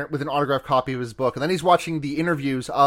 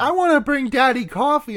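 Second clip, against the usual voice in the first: put-on little girl voice; 10 semitones higher, 3 dB louder than usual. Voicing the little daughter.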